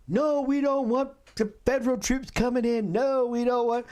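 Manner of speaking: high mock official voice, dismissive; sneering parody of the mayor. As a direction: lampoon a stubborn politician. Mocking the mayor's stance.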